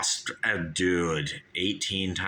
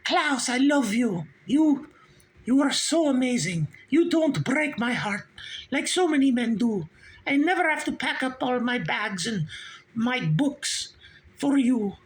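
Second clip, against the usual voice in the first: Agnetha swooning. Retold or imitated. Agnetha declaring love to Klaus in lovestruck falsetto. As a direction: coo it in a high, adoring falsetto. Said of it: "And she'd be like".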